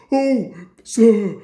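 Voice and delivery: loud, eager pleading; excited engines crying out together. A narrator's reading. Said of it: "cried the engines excitedly".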